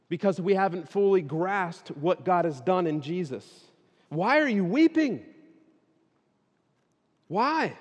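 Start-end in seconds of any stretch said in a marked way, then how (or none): 4.1-7.8 high, loud preacher's question; impassioned challenge. Real, not acted. Preacher to those weeping tonight, impassioned challenge. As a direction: press the question on them, pitched high.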